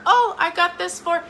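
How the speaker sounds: high, loud hurried excuse; mock excuse; justifying deflection. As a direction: explain the compliment away.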